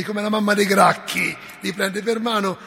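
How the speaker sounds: usual speaking voice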